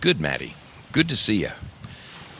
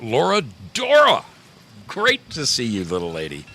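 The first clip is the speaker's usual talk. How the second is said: excited hello; 5 semitones higher, 4 dB louder than usual. Real, not acted. Calling out to a viewer.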